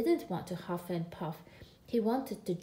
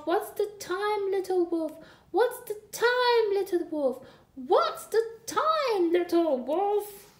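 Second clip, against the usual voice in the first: put-on a toddler wolf screaming his question over and over, louder each time; howling tantrum; 9 semitones higher, 5 dB louder than usual. A narrator's reading. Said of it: "Smells had a tantrum. He howled".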